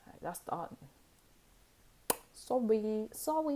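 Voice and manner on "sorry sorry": high, sing-song sorry; mock apology. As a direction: apologise without meaning it, pitched right up. Mock apology while rejecting someone.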